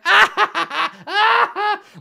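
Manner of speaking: very high, loud cackling laugh; goblin chortling. Acted. All the goblins laugh as the scene closes, chortling. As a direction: cackle shrilly like a crowd of minions. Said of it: "all the goblins just being like".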